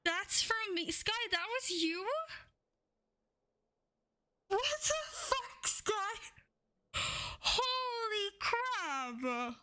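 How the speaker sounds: high-pitched, drawn-out shock; real astonishment, voice shooting up; stunned disbelief. Real, not acted. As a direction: gasp it out high, floored by a huge gift. Stunned by a surprise donation.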